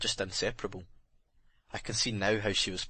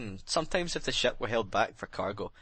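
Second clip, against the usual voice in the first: usual speaking voice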